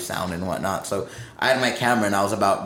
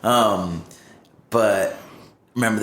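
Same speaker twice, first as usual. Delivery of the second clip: ordinary talk, nothing marked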